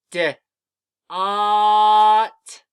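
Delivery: short "D", pause, then long loud "ot"; sounding out. Shown as a demonstration. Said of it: "Say the sounds with me".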